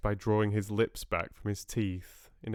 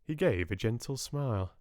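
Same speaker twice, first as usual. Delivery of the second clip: plain reading voice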